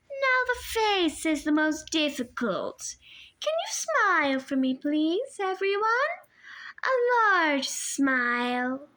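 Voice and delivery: put-on high, sugary old woman's voice, a touch foreign; sweet old lady voice. A narrator's reading. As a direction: coo it like a kindly grandmother hiding a threat. Auntie Em posing them.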